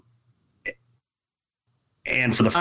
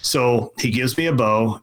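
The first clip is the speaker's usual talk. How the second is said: usual speaking voice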